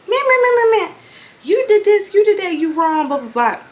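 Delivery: high loud mocking meh-meh, fading out; mocking nagging. Daughter mocking her mother's nagging on the car ride. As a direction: parody the endless nagging. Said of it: "she just".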